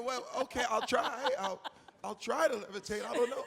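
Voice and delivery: laughing nervously while agreeing to try; a loud, giggly, unsure yes. Retold or imitated. Actor at audition laughing nervously to casting people.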